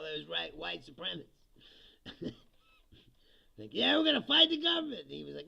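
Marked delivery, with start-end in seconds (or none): 3.7-5.0 much higher and louder blustering; hammed-up militia bravado; mock tough guys. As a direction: puff it up in a silly high boast.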